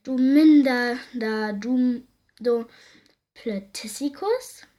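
slow, drawn-out name-calling; scolding insult. A narrator's reading. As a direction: start loud and stretch out the insult. Müllfee beschimpft das Kind. (langgezogenes Schimpfen)

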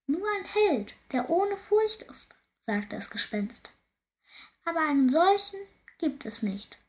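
high, sighing voice of a cursed ghost; mournful ghost. Acted. Ghost lamenting his curse to the princess, high and mournful.

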